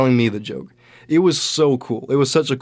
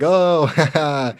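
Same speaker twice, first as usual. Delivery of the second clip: laughing; 5 semitones higher, much slower than usual. Retold or imitated. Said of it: "he got it".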